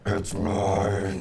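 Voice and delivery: slow demonic voice; possessed. Acted. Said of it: "I don't know where that demon voice came from".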